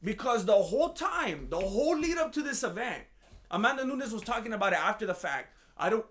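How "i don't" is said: ordinary talk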